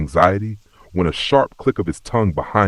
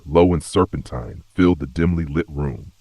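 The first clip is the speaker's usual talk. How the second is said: plain reading voice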